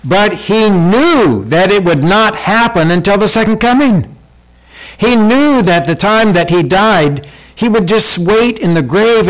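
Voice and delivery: high, loud preacher's climb; fervent preaching. Acted. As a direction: preach it full out from the pulpit. Preaching at full force.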